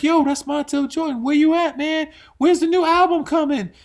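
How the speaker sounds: high, eager fan voice; excited fan. Retold or imitated. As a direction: play the starstruck fan who spotted him. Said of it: "people see me in the airport and like".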